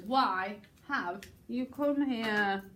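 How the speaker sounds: WHY, HAVE, YOU, COME, HERE each set apart; slow, with a gap between "Why" "have" "you"; separated words. Shown as a demonstration. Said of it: "separate the words".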